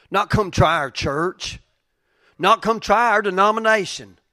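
loud, high fervent preaching; preaching at full force. Real, not acted. Pastor pleading with the congregation, loud and fervent. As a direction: cry it out with conviction.

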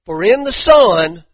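slow, loud, emphatic scripture reading; preaching scripture. Acted. Preacher reading the scripture to the congregation, slow and loud. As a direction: preach each phrase out slow and heavy.

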